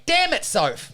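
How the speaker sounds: high-pitched imitated child's curse; son copying dad. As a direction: parrot dad's annoyed outburst as a little kid. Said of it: "he would respond by going".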